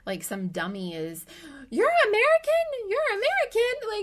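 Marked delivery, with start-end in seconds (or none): 1.7-4.0 much higher, louder dazed repeat; stunned disbelief. Retold or imitated. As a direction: squeak it out in shock, over and over. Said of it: "all I can say over and over again, like some dummy".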